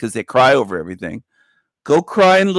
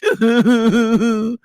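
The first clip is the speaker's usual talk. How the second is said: laughing; 9 semitones higher than usual. Real, not acted.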